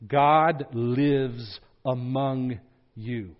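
slow, hushed declaration; slow weighty declaration; slow solemn quote. Shown as a demonstration. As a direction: let it land slowly. Climactic quote.

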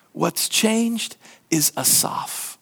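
drawn out, each word weighed; slow, weighty conclusion; slow, deliberate emphasis. Real, not acted. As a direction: land the point slowly.

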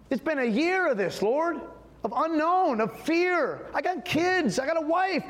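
high, pleading cry to God; pleading prayer. Retold or imitated. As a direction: beg God for answers, voice rising. Retold desperate prayer to God.

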